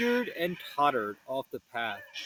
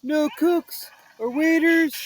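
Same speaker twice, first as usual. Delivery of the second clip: Shaggy voice; 11 semitones higher, 6 dB louder than usual. Acted.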